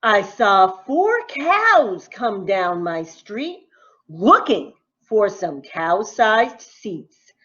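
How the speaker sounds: usual speaking voice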